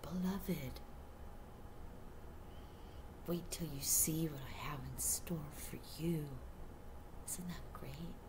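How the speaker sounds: soft whispered blessing; tender whisper. Acted. God speaking to the beloved viewer, whispered. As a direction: whisper it like a secret promise. Voicing God's loving message.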